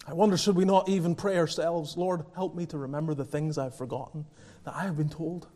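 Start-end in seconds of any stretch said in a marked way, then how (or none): 2.0-5.5 quiet prayer. Pretend. Quoted humble prayer.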